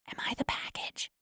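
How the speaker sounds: soft unvoiced whisper. A narrator's reading. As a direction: breathe it secretly. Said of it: "I whisper to Vanti".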